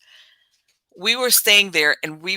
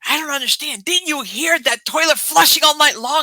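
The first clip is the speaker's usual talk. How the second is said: higher, put-on voice of an exasperated boyfriend; 9 semitones higher, 3 dB louder than usual. Boyfriend complaining to her about the toilet, mock exasperated.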